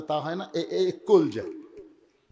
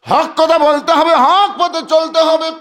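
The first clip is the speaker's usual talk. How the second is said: loud, high preacher's call; rousing exhortation; 10 semitones higher, 11 dB louder, slower than usual. Real, not acted. Preacher telling the crowd to walk in truth, rousing cry. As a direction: ring it out as a command.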